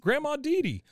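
high, loud mock-surprised boy; Bobby. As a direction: exclaim like a child spotting grandma. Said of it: "Bobby turns around and he goes".

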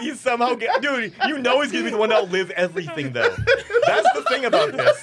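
laughing hard while talking; after laughing hard. Real, not acted. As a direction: squeeze words out through laughter. Laughing at the defects.

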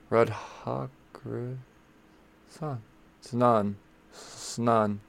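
Radhakrishnan broken up slowly with pauses, testing "Snan"; hesitant, halting practice of Radhakrishnan and its final "Snan"; searching for syllables. Real, not acted. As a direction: sound out Radhakrishnan in pieces, trying the "Snan" ending twice. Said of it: "there should be another syllable in there".

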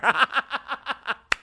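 high squealing laugh; high squealing laughter; high giggle burst. Real, not acted. Cracking up at the petition.

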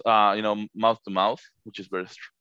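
ordinary talk

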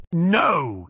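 loud, high exclamation; emphatic shout; forceful. A narrator's reading. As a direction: burst out with a forceful objection. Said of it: "exclaimed Jim forcefully".

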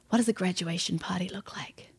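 grieving husband's whispered question; barely audible, all breath; hushed whisper. Retold or imitated. Grieving husband's quiet answer.

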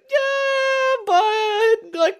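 very high, strained no; squeaky, uneasy no; squeaky refusal. Retold or imitated. Retold reply to the woman.

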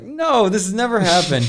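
high-pitched, emphatic mock disbelief; retold astonished denial. Tenant answering the startled installer, retold astonishment.

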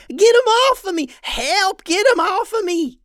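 very high, loud cartoon cry for help; panicked yelling. A narrator's reading. Hambone covered in ants begging for help, panicked yelling. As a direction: yell frantically in a high-pitched voice. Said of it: "rolled on the ground and yelled".

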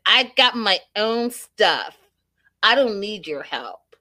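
snarky and mean. Retold or imitated. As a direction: snap back like a snob who needs no one. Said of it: "snarky and mean to Stacy".

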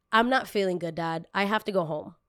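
usual speaking voice

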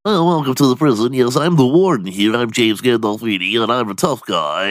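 mock the actor's goofy real voice, fast and high; nerdy Gandolfini voice. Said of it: "his normal voice, he's like".

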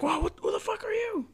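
very high, squeaky startled voice; mock shock in a falsetto; startled disbelief. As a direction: yelp it like facing a stranger. Acting someone not recognising him.

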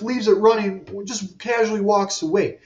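ordinary delivery

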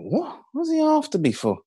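high, startled question; shocked disbelief. Retold or imitated. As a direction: sound genuinely caught off guard. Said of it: "And I was like".

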